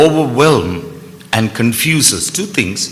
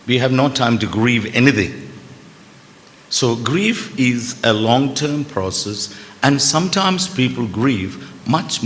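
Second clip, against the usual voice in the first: normal talking voice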